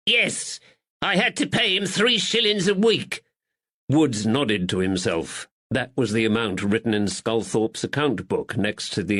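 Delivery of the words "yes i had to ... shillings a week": high, pleading northern shopkeeper; cornered man whining his innocence; frightened draper. Acted. Nervous draper pleads with the constable in a high voice.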